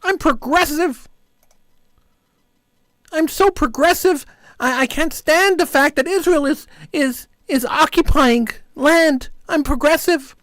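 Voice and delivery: high, slow sneering mimicry of a smug progressive; mocking progressive. Host parodying critics of Israel in a high, preening voice. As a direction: ham up their self-righteous whine. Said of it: "they tell me".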